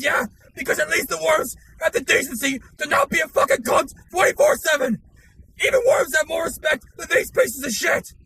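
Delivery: shouting, swearing tirade into the camera; all-out screaming fury at the camera; furious rant. Acted. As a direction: explode with rage at the VTubers.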